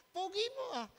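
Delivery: high, sugary mock flattery after a slap; mock sweet compliment. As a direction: sweet-talk with exaggerated charm. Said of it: "ano kaya sasabihin niyan?".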